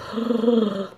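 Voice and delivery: sharp high gasping squeak, in a playful mock; platypus noise imitation. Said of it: "they do that".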